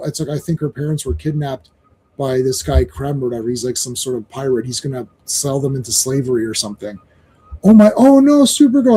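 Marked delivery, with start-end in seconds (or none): 0.3-7.0 softer and lower, a little girl telling bad news; quietly voiced worried kid; hushed worried report. Voicing a girl reporting kidnapped parents. 7.6-8.9 higher, louder gasping oh no; hammed-up shocked exclamation; mock dismay. Dismayed cry to Supergirl.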